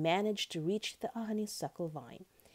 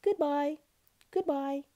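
high, loud farewell call; butterfly's ringing storybook farewell; crying out; 10 semitones higher, 7 dB louder than usual. A narrator's reading. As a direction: call goodbye from up in the sky. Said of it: "he cried".

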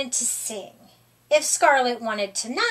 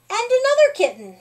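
excited; 9 semitones higher, 5 dB louder, much faster than usual. A narrator's reading. Excited counting of kittens.

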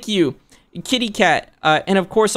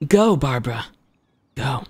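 restrained, lower character cheer; Child voice, lower take; 3 semitones lower than usual. Acted. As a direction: bring it down, pausing before the last word. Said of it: "I'll say it in Child's voice for you".

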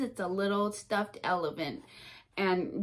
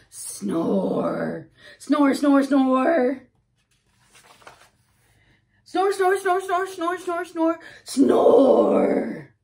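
loud, higher cartoon snores; loud snoring; 3 semitones higher, 10 dB louder, much slower than usual. Piggy napping beside Gerald, loud mock snores. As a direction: snore loud and silly like a sleeping pig. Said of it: "Piggy starts to snore".

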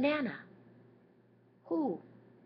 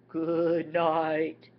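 lightly voiced gorilla, louder; a hint of a big gorilla saying good night; 7 dB louder than usual. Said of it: "The gorilla says".

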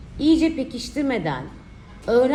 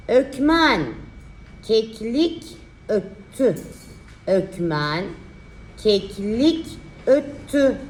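slow, drawn-out dictation; dictating; slower than usual. Shown as a demonstration. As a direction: spell out the sentence slowly. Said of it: "dikte çalışması".

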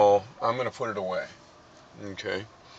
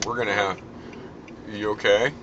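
normal talking voice

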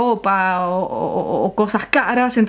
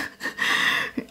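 soft unvoiced stifled laugh; a hushed, embarrassed chuckle; quietly stifled giggle; 9 dB quieter than usual. Real, not acted. Embarrassed by rude word.

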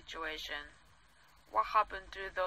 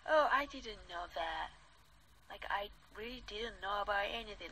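mocking quote; 2 semitones higher than usual. Mocking politicians' feigned ignorance.

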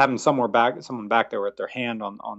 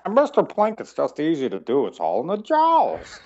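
gravelly Mister Plinkett voice; 6 semitones higher, 3 dB louder than usual. Acted. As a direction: gravelly voice from the jowls. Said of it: "the gravelly voice".

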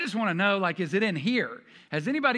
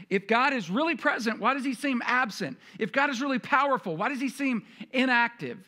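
higher and louder with each question; building anguished questions; 6 semitones higher, 4 dB louder than usual. Retold or imitated. Doubting believer pressing God with hard questions, rising.

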